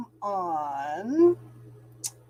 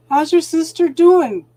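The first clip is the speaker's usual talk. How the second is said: much louder and higher after a gasp; sudden eager question; 7 semitones higher, 11 dB louder than usual. Real, not acted. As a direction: remember something all at once and blurt it. Gasps, remembers a viewer's sister.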